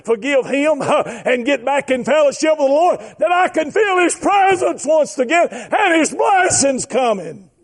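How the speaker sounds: rising preacher's chant with gasped breaks; full-force preaching. Real, not acted. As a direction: preach it out, climbing in pitch.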